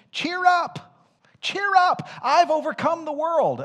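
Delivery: high, loud pulpit proclamation; preaching at full force. Acted. Preacher proclaiming Christ's victory to the congregation, full force. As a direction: ring it out to the whole church. Shouts the translation.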